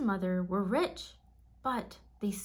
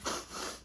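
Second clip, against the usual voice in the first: giant sniffing; 6 dB quieter than usual. A narrator's reading. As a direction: sniff the air like a hunting giant.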